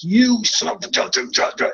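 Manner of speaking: drawn-out mock road rage; slow mock angry snarl. Retelling road rage to friends, slow mock snarl. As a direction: stretch it out like a furious driver. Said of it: "I'll be like".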